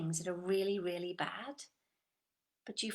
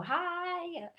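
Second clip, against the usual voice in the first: high, overexcited hi; mock high hello; 9 semitones higher, 4 dB louder than usual. Coach imitating a too-high greeting, mock excited. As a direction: hit a peak of excitement. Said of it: "you never see him go".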